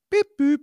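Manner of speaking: high, loud electronic beep-boop; mimicked gadget chime; imitated device beep. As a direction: chirp two short high tones like a gadget. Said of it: "'Cause it, like, goes".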